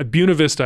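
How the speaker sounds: Buena said BYOO-nuh; local pronunciation. Shown as a demonstration. Co-host picking the right way, Buena as BYOO-nuh. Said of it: "I think, is what's right".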